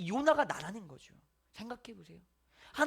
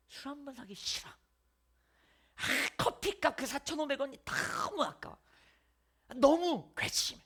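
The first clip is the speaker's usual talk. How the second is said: petty grudge; 6 semitones higher, 3 dB louder, slower than usual. Acted. Grudging coworker voiced.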